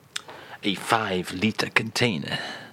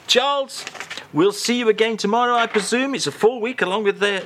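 higher sign-off; 9 semitones higher than usual. Acted. Host signs off in raised voice.

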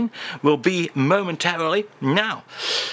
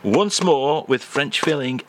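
ordinary delivery

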